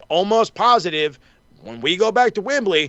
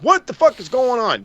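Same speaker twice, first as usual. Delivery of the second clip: baffled outburst; 8 semitones higher than usual. Retold or imitated. Fan baffled as the crowd claps for a heel, shouting. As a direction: throw up your hands in disbelief. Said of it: "and I'm like".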